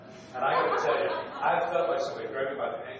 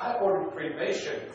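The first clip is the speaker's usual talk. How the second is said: mock protest; 5 semitones higher, 5 dB louder than usual. Acts out his protest, audience laughing.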